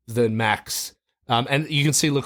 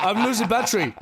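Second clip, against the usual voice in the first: put-on Dutch accent; 10 semitones higher, faster than usual. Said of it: "Can you do it in the Dutch accent?".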